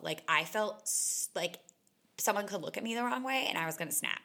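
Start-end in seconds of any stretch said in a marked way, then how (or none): none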